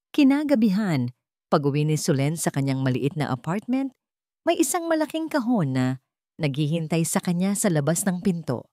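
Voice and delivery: ordinary talk, nothing marked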